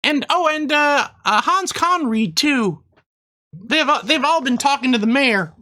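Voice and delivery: high squeaky Christmas elf; chirpy elf shopkeeper, way up high. Acted. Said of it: "I do an elf voice".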